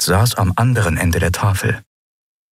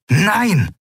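shouted, much higher no; firm no; 12 semitones higher than usual. A narrator's reading. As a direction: cut them off with a shout. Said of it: "stellte Lien klar". (scharf gerufenes Nein)

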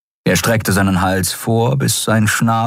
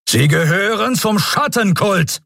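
high, accusing shout; furious admiral accuses the captive; angry accusation; 14 semitones higher than usual. A narrator's reading. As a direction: point the finger and yell it.

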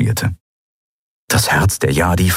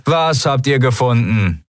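higher-pitched, irritated shout back; annoyed call; 6 semitones higher than usual. A narrator's reading. Annoyed pirate calls back to the Skria, irritated. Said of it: "rief jemand genervt".

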